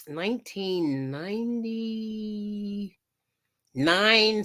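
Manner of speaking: very drawn-out, held while thinking; searching for the year. Real, not acted. Streamer recalling when the stone got popular, drawn-out and searching. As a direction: stretch the number out slowly as you recall it.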